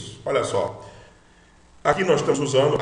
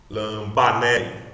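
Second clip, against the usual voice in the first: slow Lambané with a strong middle "ba"; stress on BA; 2 semitones lower, much slower than usual. Shown as a demonstration. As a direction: model Lambané slowly, stressing "ba". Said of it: "a sílaba forte é o ba".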